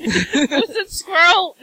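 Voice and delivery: loud, high-pitched pretend command; mock cartoon shout. Joking with co-hosts after Russian accent talk, mock cartoon shout. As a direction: play at yelling like a cartoon villain.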